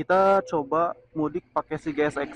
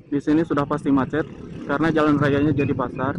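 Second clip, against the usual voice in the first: talking over engine noise; 2 semitones higher, 5 dB louder than usual. Real, not acted. Talking while riding the motorcycle.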